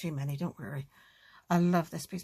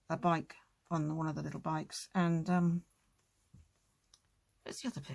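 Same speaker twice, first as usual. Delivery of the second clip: ordinary talk, nothing marked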